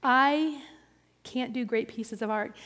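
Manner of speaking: usual speaking voice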